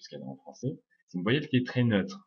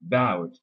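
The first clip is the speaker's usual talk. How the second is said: "About" with BOUT punched louder; strong syllable; 6 dB louder than usual. Shown as a demonstration. Teacher showing the strong syllable, BOUT stressed in "About". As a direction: hit the BOUT of "About" hard. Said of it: "c'est une syllabe qui est forte".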